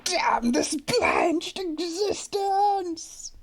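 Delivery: slow, loud, high lament; melodramatic cry. Acted. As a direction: wail at the heavens. Melodramatic outburst.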